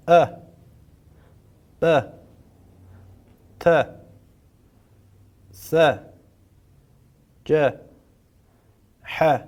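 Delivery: each letter with a short a, one beat, no stretch; single-beat fatha letters. Shown as a demonstration. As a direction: clip every letter short for repeating. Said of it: "به صورت تک ضرب و بدون کشش". (حروف با فتحه، تک ضرب و بدون کشش)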